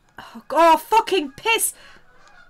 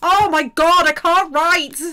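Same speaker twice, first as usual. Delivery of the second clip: panicked shout; 8 semitones higher, 10 dB louder than usual. Real, not acted. Panicked while drawing.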